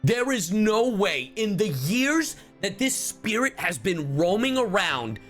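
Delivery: usual speaking voice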